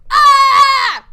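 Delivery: high, loud wild scream; banshee scream. Acted. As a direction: shriek like a banshee as you charge. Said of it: "screaming like a banshee".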